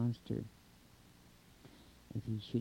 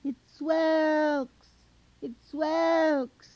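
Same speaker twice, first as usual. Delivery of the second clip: high, long-drawn call across a distance; loud far-carrying call; yelling out; 9 dB louder, much slower than usual. A narrator's reading. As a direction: holler the name, stretching it out high and loud. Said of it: "yells out".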